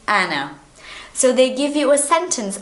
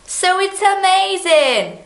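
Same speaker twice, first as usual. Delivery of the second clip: bright burst of enthusiasm, much higher; high, delighted exclamation; excited; 10 semitones higher, 4 dB louder than usual. Real, not acted. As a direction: sell it as amazing news. Teacher enthuses about verb to be.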